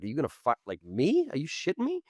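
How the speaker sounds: mock outrage, voice jumping way up; are you kidding me, disbelief; incredulous.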